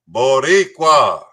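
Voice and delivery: usual speaking voice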